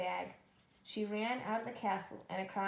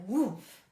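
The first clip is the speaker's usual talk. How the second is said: thud sound; 11 dB louder than usual. A narrator's reading. Giant hitting ground.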